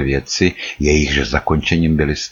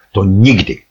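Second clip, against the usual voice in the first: "nikdy" pitched up with special emphasis; stress on NIKDY; 3 semitones higher, 4 dB louder than usual. A narrator's reading. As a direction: lean hard on "nikdy" as a firm refusal. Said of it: "na slovo nikdy položil zvláštní důraz". (se zvláštním důrazem na slovo „nikdy“)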